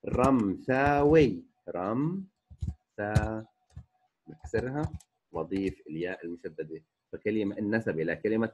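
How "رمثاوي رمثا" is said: رمثاوي stretched out, then رمثا in slow separate parts; very slow teaching model of رمثاوي; syllable-by-syllable demonstration. Shown as a demonstration. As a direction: hold each syllable of رمثاوي for learners. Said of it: "رمثا بتصير أيضًا".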